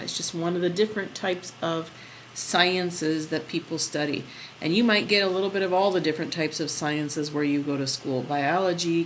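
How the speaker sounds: ordinary delivery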